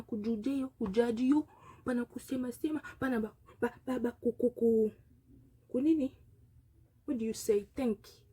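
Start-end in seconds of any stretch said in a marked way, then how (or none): none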